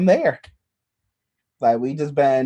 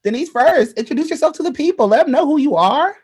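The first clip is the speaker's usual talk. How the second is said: hyped host; 10 semitones higher, 3 dB louder than usual. Acted. Hyped host introducing guest.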